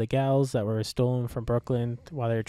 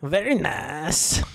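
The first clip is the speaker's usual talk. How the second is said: drawn-out, hammed-up catchphrase accent; Borat; 3 semitones higher, much slower than usual. Co-host showing his only accent, slow comic foreign accent. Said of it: "The only thing I can do is Borat".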